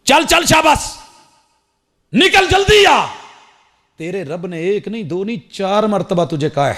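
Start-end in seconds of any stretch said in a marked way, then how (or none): 0.0-3.1 stern shout. Retold or imitated. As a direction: bark the son out of the toy aisle. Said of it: "پھر باپ نے کہا".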